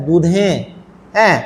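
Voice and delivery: usual speaking voice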